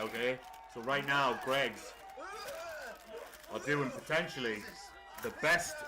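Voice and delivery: upbeat product plug; ad read. Acted. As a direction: pitch the donut like an advert. Sponsor-style donut recommendation.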